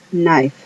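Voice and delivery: knife with silent K, "naif"; English word knife. Shown as a demonstration. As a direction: drop the K in knife. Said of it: "la K de kilo no suena". ("knife" sin la K)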